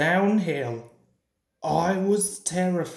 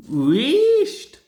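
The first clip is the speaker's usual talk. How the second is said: hoarse whisper; 8 semitones higher, 8 dB louder than usual. A narrator's reading. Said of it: "whispered Donald hoarsely".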